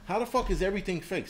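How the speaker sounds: retold stunned reaction, high and disbelieving; incredulous outburst. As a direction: act baffled by the claim, pitch flying up. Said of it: "I'm like".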